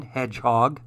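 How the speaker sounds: plain reading voice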